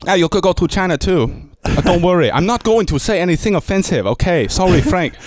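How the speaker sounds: lightly exaggerated Chinese voice; Chinese accent. Shown as a demonstration. Comic attempting a Chinese accent for the host. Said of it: "probably not even a Chinese accent".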